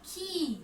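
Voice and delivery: "खी" high, with a long falling ee; forceful, stretched vowel. Shown as a demonstration. Teacher repeating after telling pupils to stretch it, long held ee. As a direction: draw out the ee of "खी" for pupils to copy. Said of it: "जोर से बोलना है इसे खींच कर".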